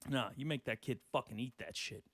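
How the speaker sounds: usual speaking voice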